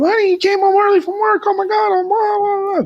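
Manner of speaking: very high mock-wife falsetto; hammed-up falsetto wife caught off guard; squealing wife.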